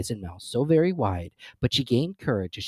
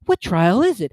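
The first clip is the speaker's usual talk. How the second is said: panting Alice; 9 semitones higher, 9 dB louder than usual. A narrator's reading. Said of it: "Alice panted as she ran".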